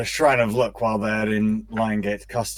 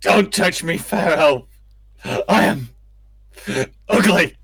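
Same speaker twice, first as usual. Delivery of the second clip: raised, hammed-up self-pity; melodramatic wounded dwarf; 7 semitones higher, 4 dB louder than usual. Dwarf begging the priestess not to touch him, hammed-up anguish. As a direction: wail it like a tragic hero.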